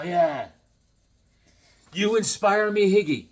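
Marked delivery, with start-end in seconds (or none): none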